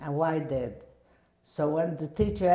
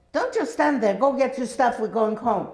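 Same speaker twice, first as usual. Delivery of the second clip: high, loud urgent call to hurry; urgent shout; 8 semitones higher, 7 dB louder than usual. Retold or imitated. Sister at the door shouting for her to come home, urgent. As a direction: yell at her to move, now.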